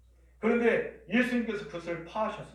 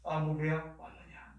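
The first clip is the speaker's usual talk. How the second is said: slow, soft, tender welcome; affectionate calling; 3 semitones lower, 6 dB quieter, slower than usual. Acted. God welcoming each arriving worshipper by name, affectionately. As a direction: greet them gently, drawing out each word. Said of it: "다정하게 이 현장에 부르십니다".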